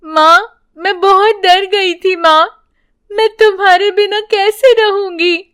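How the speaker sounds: put-on high, lightly weepy girl; a hint of a sobbing daughter; tearful child. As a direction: cry with relief to mother. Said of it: "वीणा रोते हुए".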